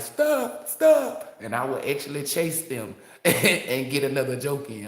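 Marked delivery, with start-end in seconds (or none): 0.0-1.4 high pleading stop; begging friend. Retold or imitated. Friend begs the joker to quit, high pleading. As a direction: plead high, louder on the second. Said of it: "people that be like".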